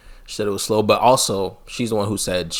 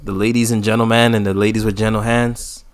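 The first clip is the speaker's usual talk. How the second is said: Jakes; 6 dB louder than usual. Retold or imitated. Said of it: "He'd be like".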